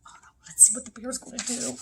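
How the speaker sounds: quick, hushed worried aside; suspenseful whisper. Pretend. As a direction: whisper it fast, building suspense. Suspense aside before the sneeze.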